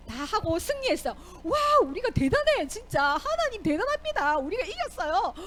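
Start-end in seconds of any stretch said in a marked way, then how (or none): 1.4-5.3 higher, excited triumph; victory cheer. Acted. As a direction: crow over the win. 승리한 백성의 환호. (승리에 들뜬 환호)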